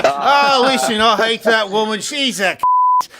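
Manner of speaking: hammed-up Jim Jeffries, stretch the last word. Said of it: "He'd say".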